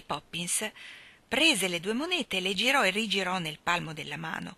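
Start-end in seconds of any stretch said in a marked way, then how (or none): none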